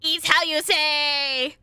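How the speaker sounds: slow, high, loud mock French accent. Reader parodying a French speaker, exaggerated French accent. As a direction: draw it out in a silly French accent. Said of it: "I'm being so racist".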